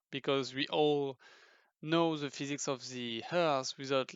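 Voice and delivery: ordinary delivery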